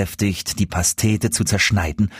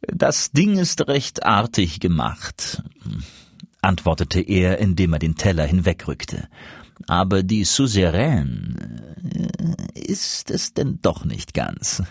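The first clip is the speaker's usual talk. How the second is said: a polite sneer at the dish; mocking; 3 semitones lower than usual. A narrator's reading. Guest sneers mildly at the duke's pie. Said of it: "lächelte dabei höhnisch und geheimnisvoll".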